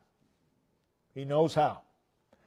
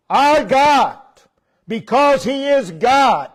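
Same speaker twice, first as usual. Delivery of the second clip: preaching emphasis; 5 semitones higher, 4 dB louder, slower than usual. Real, not acted. Preacher's climax.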